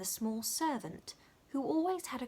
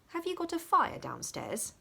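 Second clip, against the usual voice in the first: raised, curious question; lightly voiced Dick questioning; Dick asking; 10 semitones higher than usual. A narrator's reading. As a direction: ask kindly about the fire. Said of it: "said Dick".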